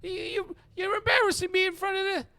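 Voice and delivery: very high, loud pleading small-time crook; flustered high-pitched protest; embarrassed pleading. Acted. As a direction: beg him in a high, squeaky voice. Mo pleads, embarrassed before woman.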